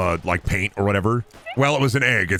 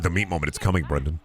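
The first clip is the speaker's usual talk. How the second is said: lower, fading mock butcher warning; 5 semitones lower, 4 dB quieter, faster than usual. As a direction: lean in low as the butcher warning Brendan.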